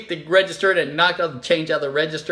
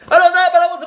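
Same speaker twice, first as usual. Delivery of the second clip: whiny tantrum; 11 semitones higher, 9 dB louder, faster than usual. Acted. Child throwing a tantrum at the register, whining shout. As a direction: wail it like a toddler throwing a fit. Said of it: "sounded like this".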